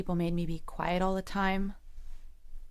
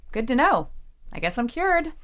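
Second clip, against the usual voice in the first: sarcastic; 4 semitones higher, 5 dB louder than usual. Acted. Sarcastic after trauma remark.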